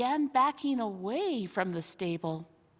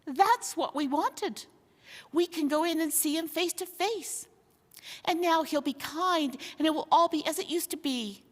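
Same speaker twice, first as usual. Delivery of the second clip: higher, fast, breathless hope; eager joy; 7 semitones higher than usual. Acted. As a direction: rush out the happy plan. Crowd of beasts overjoyed.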